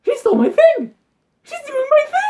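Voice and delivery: high, squeaky whiny mock voice; mocking the other driver. Mocking the driver ahead in a high squeaky whine. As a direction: squeal it like a petty child. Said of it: "I'm just like this".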